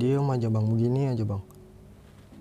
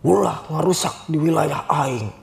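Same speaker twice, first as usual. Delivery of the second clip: slow, louder spirit warning; possessed voice; 3 semitones higher, 4 dB louder, slower than usual. Retold or imitated. Spirit inside the friend warns hikers, slow loud voice. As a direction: warn them off like a territorial spirit.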